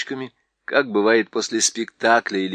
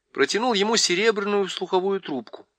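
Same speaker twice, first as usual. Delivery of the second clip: ordinary delivery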